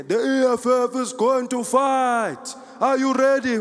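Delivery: high, slow rally cry; declaiming party-leader call; Julius Malema. Retold or imitated. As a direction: work up a crowd of supporters. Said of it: "Every day he was there".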